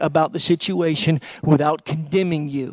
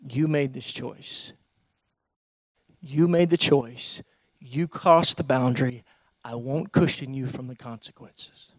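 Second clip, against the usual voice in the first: loving firmness; 6 semitones lower, 6 dB quieter than usual. Retold or imitated. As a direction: tell them lovingly to face it. Voicing a loving restrainer.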